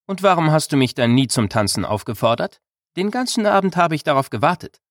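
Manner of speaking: a hint of a pouting, challenging maiden; playful young woman. A narrator's reading. Anini teases Rowan.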